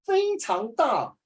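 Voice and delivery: 非常大 said high and slow, stretched out; expressive emphasis. Shown as a demonstration. Teacher demonstrating mood, slow and stretched. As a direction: draw out 非常大 to show bigness. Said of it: "you must bring out the moods".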